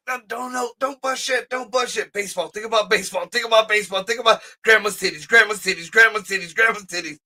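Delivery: high, rapid-fire pleading chant; frantic self-talk. Acted. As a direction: repeat it urgently to distract yourself. Acting out frantic inner self-talk.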